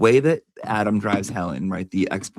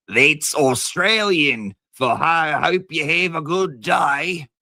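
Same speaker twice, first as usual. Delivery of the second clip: higher and slow mock Aussie drawl; mock Australian accent; 7 semitones higher, slower than usual. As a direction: explain it like a smug Aussie. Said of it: "Aussie's like".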